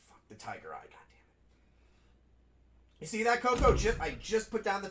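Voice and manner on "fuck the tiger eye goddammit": real whisper, much softer and lower; annoyed whisper. Real, not acted. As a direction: curse under your breath at the game. Annoyed at game, muttering.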